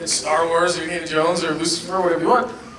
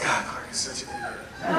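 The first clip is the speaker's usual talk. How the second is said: exasperated aside; 14 dB quieter than usual. Retold or imitated. Exasperated inner voice while watching.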